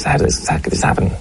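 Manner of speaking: breathy despairing whisper. Acted. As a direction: ask it under your breath, defeated. Host asks in mock despair.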